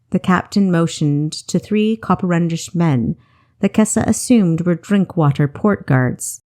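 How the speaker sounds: ordinary delivery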